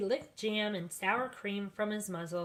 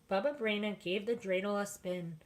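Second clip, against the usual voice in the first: usual speaking voice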